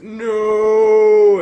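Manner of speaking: a high, loud hammed-up "No!"; mock heroic yell. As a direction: leap in front of the bullet, mock dramatic. [name] went.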